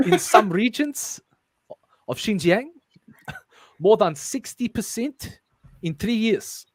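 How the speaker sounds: put-on South African accent. Reader carrying on with the script, South African accent. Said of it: "work on my South African accent".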